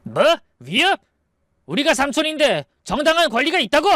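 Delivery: high, outraged questions; raging protest. Acted. Eldest brother accused of being a threat, raging. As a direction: protest furiously, pitch leaping upward. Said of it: "첫째 형님이 발악했어요".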